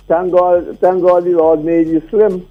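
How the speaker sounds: usual speaking voice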